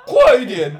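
loud, high hurry-up yell; impatient shout. Retold or imitated. Officer hurries the shaking cook, loud impatient shout. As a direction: push her to hurry, loudly.

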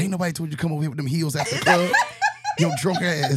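fast, loud teasing through laughter; laughing. Pretend. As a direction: scold them while cracking up. Teasing a drunk clubgoer.

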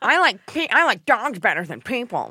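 high whiny dog person; mock dog lover. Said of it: "you sound like one of those people".

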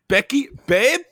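high, loud call to babe; bro calling out. Acted. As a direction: call your girl across the room. Brody calls Becky.

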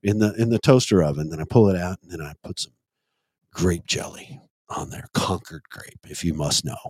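low sultry croon, easing into ASMR. Acted.

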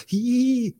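high mock giggle of pranksters; giddy squeal. Imitating giddy housemates pranking a teammate, high squeal.

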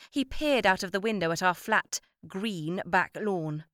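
ordinary delivery

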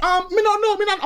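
very high, flustered-sounding Jamaican patois; imitated Jamaican accent. Neighbour backtracks to the visitor, imitated Jamaican accent. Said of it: "He was like".

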